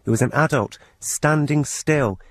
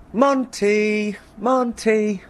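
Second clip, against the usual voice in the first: a much higher, cheerful mock call of the cat's name; imitating wife's high call; 10 semitones higher, much slower than usual. Wife calling the cat from the front door, imitated high call. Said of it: "shout for our cat in a cheerfully high-pitched".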